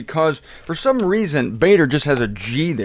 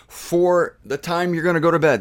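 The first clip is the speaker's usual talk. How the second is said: ordinary delivery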